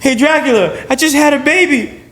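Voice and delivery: high, pleading mock victim voice; mock plea to Dracula. Victim begging Dracula to spare grandmother, high mock plea. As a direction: play a naive victim begging a monster. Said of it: "No matter how much you appeal to Dracula".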